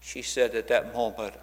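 ordinary talk

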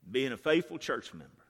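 normal talking voice

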